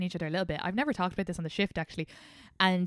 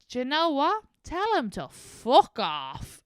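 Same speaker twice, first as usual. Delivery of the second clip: Irish conductor; 7 semitones higher, 5 dB louder, slower than usual. Retold or imitated. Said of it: "The Irish conductor comes back and goes".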